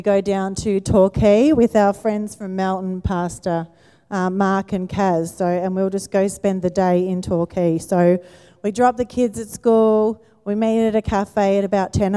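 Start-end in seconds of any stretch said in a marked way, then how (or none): none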